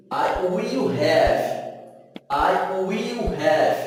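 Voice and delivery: "I will have" said slowly, leaning on "will"; slow teacher model. Shown as a demonstration. Teacher drilling students, slow and clear. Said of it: "Eu terei".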